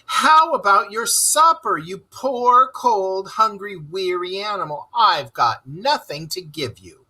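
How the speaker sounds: high, lamenting whine; despairing moan. Acted. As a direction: moan it as if all is lost. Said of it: "he moaned".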